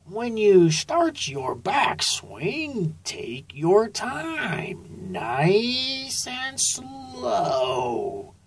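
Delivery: very slow, soft, high coach. A narrator's reading. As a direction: draw it out, nice and slow. Turtle coach advises slowly.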